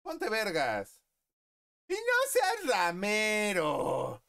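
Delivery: high, slow, loud drawn-out order; mock command. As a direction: bellow it slowly. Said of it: "Es de que".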